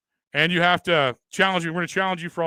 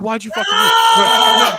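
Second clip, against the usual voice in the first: slow, drawn-out taunting yell, louder; mock shout; 5 semitones higher, 9 dB louder, much slower than usual. Taunting a retold rival with a slow yell. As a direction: stretch out the taunt. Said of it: "I'm like".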